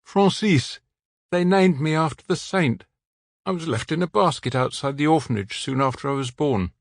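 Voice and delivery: recalling the orphanage; man with local French accent, sad. A narrator's reading. The man gives his name.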